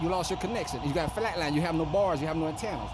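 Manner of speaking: ordinary talk, nothing marked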